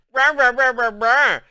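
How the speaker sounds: high, loud wordless growl. A narrator's reading. Girl growling at her mother while carried off, loud. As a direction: snarl like an angry animal. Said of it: "Deli growled".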